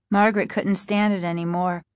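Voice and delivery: plain reading voice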